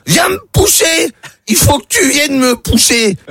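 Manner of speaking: put-on high, slow drunken bellow; drunk pleading. Drunk man at the door begs to be pushed, slow drunken yell. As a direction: drag the words out, sloshed and insistent.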